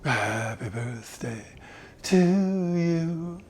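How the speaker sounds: soft, airy breathy singing; too much air. Shown as a demonstration. Voice coach demoing an airy breathy voice. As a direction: sing it with air leaking out. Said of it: "there's just too much air coming out".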